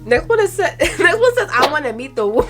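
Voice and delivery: high, giggly read-out; laughing hard. Real, not acted. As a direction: try to read it out while cracking up. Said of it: "I don't know why I'm laughing so hard".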